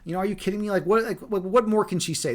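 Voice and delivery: ordinary delivery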